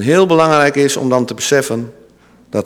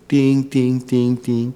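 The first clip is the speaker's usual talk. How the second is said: triangle ting; 4 dB quieter, slower than usual. Acted. Imitating the triangle.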